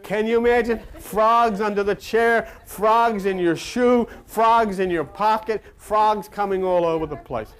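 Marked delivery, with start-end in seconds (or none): none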